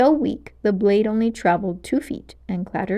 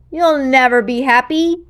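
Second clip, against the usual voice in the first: menacing witch voice; 3 semitones higher, 7 dB louder than usual. Acted. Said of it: "she managed to growl".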